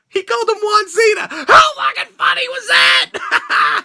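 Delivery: high, near-crying laughter; giddy high delight; laughing disbelief. Real, not acted. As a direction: squeal it out amused. Cracking up at own joke.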